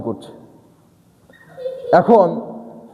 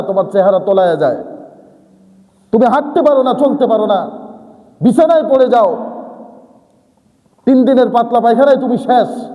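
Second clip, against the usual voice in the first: scolding; 5 semitones higher than usual. Acted. Preacher voicing a stern rebuke to an arrogant servant. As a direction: scold a proud servant, rising to a peak. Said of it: "আল্লাহ তার বান্দাকে বলছেন".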